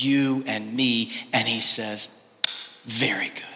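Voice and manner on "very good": "Very good." breathed as a hushed whisper; a reverent whispered "Very good."; awed whisper. Acted. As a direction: let God's verdict fall in a soft whisper. God's verdict on humankind, whispered.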